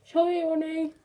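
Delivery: muffled, cheeks stuffed; mouth full. Real, not acted. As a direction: push it out past marshmallows. Marshmallows in mouth.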